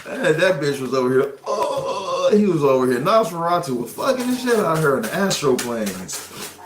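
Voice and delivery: ordinary talk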